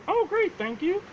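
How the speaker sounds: high squawky parrot copying her words; parrot echo. Retold or imitated. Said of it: "under the trench coat, you hear".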